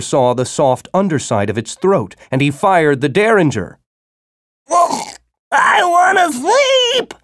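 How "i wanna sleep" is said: very high, slow howl; a giant worm's high drawn-out wail. A narrator's reading. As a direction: howl it out like a roused beast. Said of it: "the worm howled".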